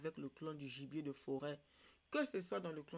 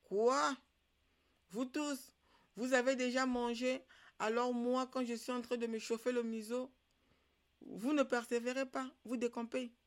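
high, indignant dog's protest; storyteller voicing an outraged dog; grumbling dog; 6 semitones higher, 5 dB louder than usual. A narrator's reading. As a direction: protest loudly, pitched well up. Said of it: "Le chien grogna".

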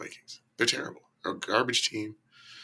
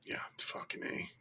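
whispered; 16 dB quieter than usual. Real, not acted. Muttered curse under breath.

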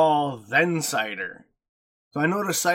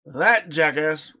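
ordinary delivery

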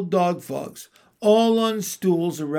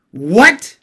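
much louder, outraged roar; 3 semitones higher, 12 dB louder than usual. A narrator's reading. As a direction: roar it in fury. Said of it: "roared the witch".

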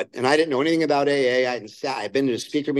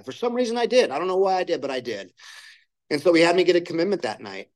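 usual speaking voice

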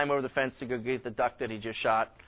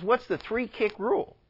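higher-pitched, puzzled question; 8 semitones higher than usual. Acted. Lawyer asking the farmer about his rule, puzzled. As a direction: ask it, mildly baffled.

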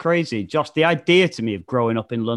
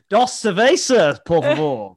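mock Spanish; 3 semitones higher, 4 dB louder than usual. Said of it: "picked that shit up".

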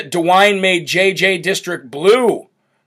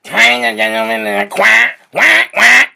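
higher quacking cartoon villain voice; Penguin voice; 4 semitones higher, slower than usual. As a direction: squawk it out like a cartoon bird-man. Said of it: "He sounds like the penguin".

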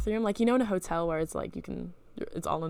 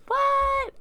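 very high, loud acted yelp; shocked squeal; 8 dB louder than usual. Dream: hearing what the men did.

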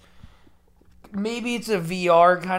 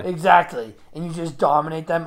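usual speaking voice

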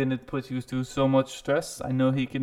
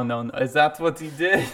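normal talking voice

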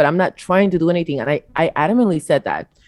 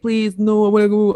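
mock pleading; 2 semitones higher than usual. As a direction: imitate him begging, stretching the words. Man pleading to come along.

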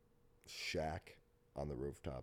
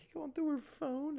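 high accusing voice; 5 dB louder than usual. Retold or imitated. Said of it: "people were like".

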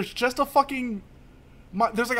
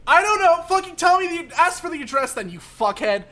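higher, louder angry outburst; ranting at the unhelpful cop; retold angry rant; 9 semitones higher, 4 dB louder than usual. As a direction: blow up at the cop. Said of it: "And he goes".

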